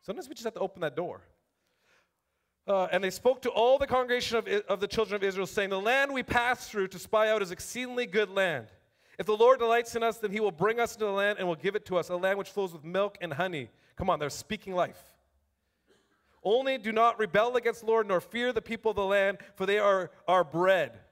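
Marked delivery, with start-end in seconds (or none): 2.7-13.7 raised, ringing Bible reading to the room; scripture reading. Acted. Preacher reading the spies' report aloud to the church, proclaiming. 16.4-21.0 raised, ringing Bible reading to the room; scripture reading. Acted. Preacher reading the spies' report aloud to the church, proclaiming.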